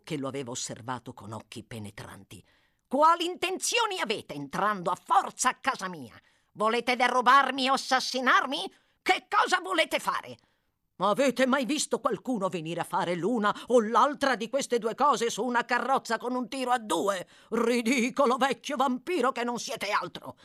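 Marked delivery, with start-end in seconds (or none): 2.9-10.4 high, rising demand to the intruder; indignant challenge. Acted. 11.0-20.3 higher, louder scornful retort; irritable exclamation. A narrator's reading. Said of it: "esclamò l'irritabile medico".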